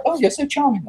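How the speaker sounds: very high, mock-gushing voice; charmed admirer. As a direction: imitate a smitten listener in a high pitch. Voicing people charmed by the accent.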